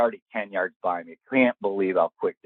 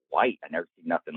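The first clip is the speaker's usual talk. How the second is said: ordinary talk, nothing marked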